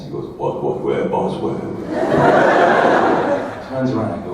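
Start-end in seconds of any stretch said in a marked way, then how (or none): 0.4-1.5 lower, mock-flustered giant; a hint of a big man caught off guard; low, hurried what-where; startled Andre.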